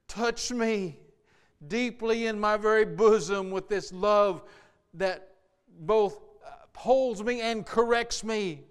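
loud, high pleading prayer; impassioned pleading prayer to the Lord; fervent prayer. Acted. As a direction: cry out to God with all your heart. Fervent prayer at full force.

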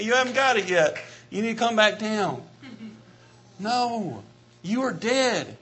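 ordinary talk, nothing marked

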